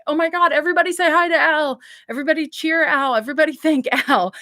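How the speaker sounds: fast, high, loud crowd hype; excited hype. Retold or imitated. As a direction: rev up the audience like a streamer. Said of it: "and it was like".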